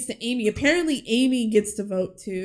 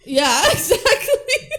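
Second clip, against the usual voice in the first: laughing high yes; 10 semitones higher, 5 dB louder, slower than usual. Real, not acted. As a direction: laugh through the word. Laughing agreement.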